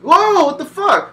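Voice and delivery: high, slow, loud mock outrage. Player shocked his character quit, loud mock outrage. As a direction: stretch out the disbelief.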